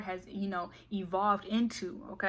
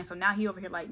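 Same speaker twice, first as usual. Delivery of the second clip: ordinary delivery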